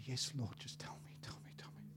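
hushed, breathy yes to the Lord; a believer breathing yes to God; whispered eager reply. Pretend.

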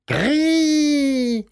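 high, long gull screech; seagull cry. A narrator's reading. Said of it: "schreit sie laut".

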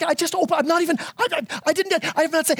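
high, sputtering protest; trying to get a word in; flustered dad. Retold or imitated. Dad interrupted by his kids, high flustered sputter.

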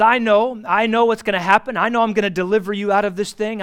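loud, fervent assurance, fast at the end; God's loud promise. Acted. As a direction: proclaim it boldly like God promising rescue. God speaks, promising deliverance.

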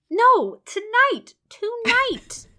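high, loud demanding tonight; insistent cry. Acted. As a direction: stamp it out, she won't wait. Jane insists he tell her.

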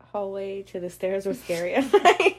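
breaks into a laugh at the end; a real laugh escapes mid-sentence. Real, not acted. Recalling the scary hallway from childhood, laughing.

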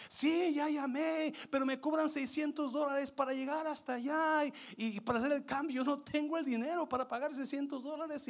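very high, wailing complaint; mock a desperate customer's tearful whine; retold crying woman. Crying woman tells the instructor she lacks the money, imitated high wail. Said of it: "Y llora y llora la señora".

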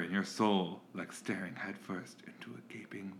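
dramatic hushed whisper. Pretend. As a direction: deliver like a spooky quote. Mock-dramatic whispered quote.

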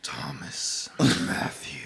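slow, creepy whispered name; sketchy whisper. Pretend. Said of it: "That's so sketchy".

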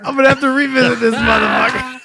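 loud, high mock shout; imitating Steve Austin. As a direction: yell it like the cold hit you. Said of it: "Listen, listen to him".

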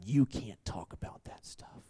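hushed whisper, voice dropped away; whispered warning. Retold or imitated. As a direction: whisper it like a secret rebuke. Guy warning speaker after booth.

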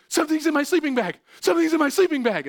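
high, loud, fast terrified yelling; frantic child shrieking for his parents; panicked scream. Retold or imitated. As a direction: scream it like a scared kid fleeing the sleeping bag. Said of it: "and I'm like".

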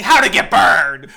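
loud, drawn-out movie freak-out shout; Nicolas Cage screaming. Acted. Host quotes actor raging with a doll, imitated scream. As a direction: bellow it slowly, hammed up. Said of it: "holding a, a, a doll and screaming".

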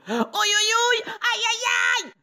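very high, loud acted yelping; cried-out yelps. A narrator's reading. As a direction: yelp like a burnt cartoon wolf. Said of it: "crie le loup". (cris de douleur joués, très aigus)

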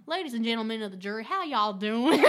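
a Southern lawyer greeting the jury. Retold or imitated. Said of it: "in the South we go".

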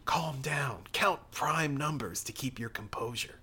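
hushed whisper, calming himself; whispered inner thoughts. Acted. The priest whispers to himself amid the frogs. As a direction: whisper it to yourself to stay calm.

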